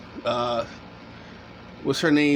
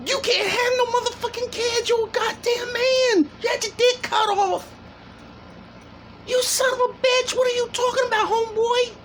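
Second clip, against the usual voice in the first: high-pitched outraged yelling; furious shouting. Real, not acted. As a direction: rage at him at the top of your voice. Furious rant at guest on video.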